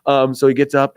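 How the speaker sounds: ordinary talk, nothing marked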